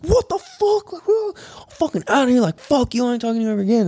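very high-pitched mock outrage; retold losing it. Storming out on girlfriend, high-pitched retold outrage. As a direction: replay a meltdown in a squeaky high voice. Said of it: "lose my shit".

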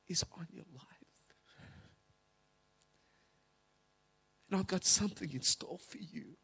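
halting, emotional delivery with long pauses; choked with emotion. Real, not acted. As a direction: struggle through the words, moved. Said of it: "without getting too emotional".

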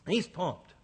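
normal talking voice

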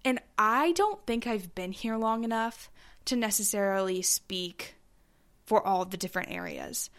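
usual speaking voice

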